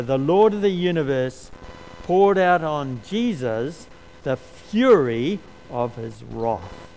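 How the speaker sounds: solemn emphatic quotation; emphatic reading. Acted. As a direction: read it with mounting gravity. Reading quoted theologian with force.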